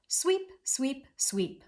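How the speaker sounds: three separated "sweep"s, high then stepping down; sweeping motion. Shown as a demonstration. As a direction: say each "sweep" like a stroke of a broom. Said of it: "in kind of almost a sweeping motion".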